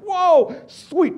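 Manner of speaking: high, loud amazed exclamation; delighted surprise. Retold or imitated. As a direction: exclaim at a lucky find. Finds twenty applications in bag.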